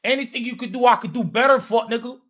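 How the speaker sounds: loud, high fired-up rant; hyped shouting. Real, not acted. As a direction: trash talk at full volume. Hyped rant about the match.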